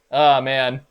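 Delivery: normal talking voice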